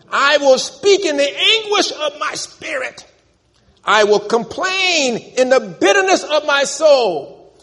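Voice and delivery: slow, high, loud lament; anguished cry. Retold or imitated. Job crying out his bitterness, loud anguished lament. Preacher voicing Job's anguish.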